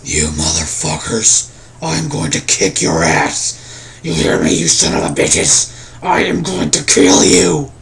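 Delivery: slow, very low villain voice, rising to a loud threat; Auric. Shown as a demonstration. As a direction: drop deep and menace them slowly, straining the throat. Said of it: "here's my Auric voice".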